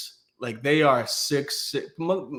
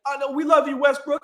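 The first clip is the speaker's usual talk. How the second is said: much higher, meek mock-sweet voice; backing down; 9 semitones higher than usual. Heckler backing down to Westbrook, meek mock-sweet high voice. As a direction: grovel to get out of trouble. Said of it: "full bitch mode".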